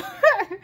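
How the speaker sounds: sudden high-pitched laugh; embarrassed high laugh at her own forgetfulness; high burst of laughter. Real, not acted. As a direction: break into a squeaky laugh. Laughs at forgetting Jackson and Lydia.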